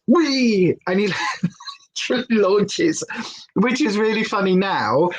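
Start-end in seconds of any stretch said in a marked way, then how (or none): 0.1-0.8 puppy wee. Retold or imitated. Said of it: "and then he goes".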